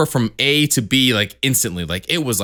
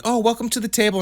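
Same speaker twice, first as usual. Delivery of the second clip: much higher sing-song greeter voice; mock cheery welcome; 12 semitones higher than usual. Imitating welcoming players at the blackjack table, high mock cheer. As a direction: gush like an overfriendly table regular.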